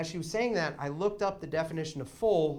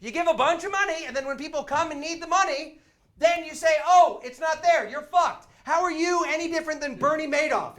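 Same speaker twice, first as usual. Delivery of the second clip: loud, high-pitched indignant rant; retold furious tirade, voice raised; angry confrontation; 12 semitones higher, 8 dB louder than usual. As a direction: demand answers like an outraged customer. Acted furious argument with insurance agent.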